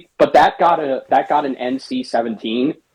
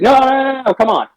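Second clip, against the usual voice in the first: high, insistent mock soldier voice; pushy soldier; 13 semitones higher, faster than usual. Retelling a game scene, the soldier insists in a high mock voice. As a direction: wave off his refusal, pitched up. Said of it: "He's like".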